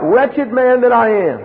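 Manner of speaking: Paul crying out in misery; anguished cry. Retold or imitated. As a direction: cry it out in despair. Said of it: "Paul cries out".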